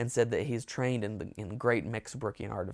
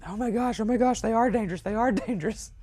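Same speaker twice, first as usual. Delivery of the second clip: high-pitched, hammed-up alarm; comic frantic warning; mock panic; 12 semitones higher, 5 dB louder than usual. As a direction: oversell the fright, pitched way up and louder. Overselling a fall in retold skit.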